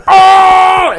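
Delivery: loud sudden yelp of pain; high startled shout of pain; mock pained yell. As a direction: yelp like the glass just went in. Cut hand on glass, screams.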